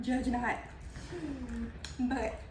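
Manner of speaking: talking while chewing; mouth full. Real, not acted. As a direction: say it through a mouthful. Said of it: "stop talking with your mouth full".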